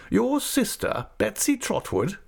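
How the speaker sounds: stern aunt. Acted. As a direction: play the decisive old aunt. Aunt speaks, voiced high.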